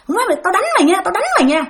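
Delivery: acted-out cursing threat, high-pitched; insistent mock menace; retold threat. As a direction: imitate an angry man threatening a beating. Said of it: "ổng chửi hoài vậy".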